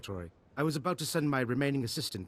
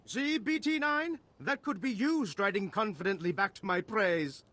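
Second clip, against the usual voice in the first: gloating delight; 7 semitones higher than usual. Acted. Scientist showing off the hero to the assistant, gloating. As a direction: rub it in, pitched high.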